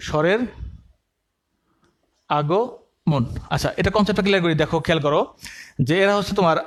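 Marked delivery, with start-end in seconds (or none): 0.1-3.2 slow dictation. Shown as a demonstration. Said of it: "পাশে লেখা রাখো তোমার স্বরের আগমন".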